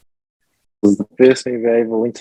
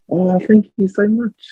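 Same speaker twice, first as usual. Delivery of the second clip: gushing thanks; 9 semitones higher, slower than usual. Retold or imitated. Retelling grateful reply to lady.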